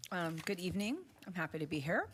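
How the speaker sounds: talking around a mint; words round a mint in the mouth. Real, not acted. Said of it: "Sorry, I have a mint in my mouth".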